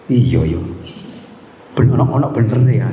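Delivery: ordinary delivery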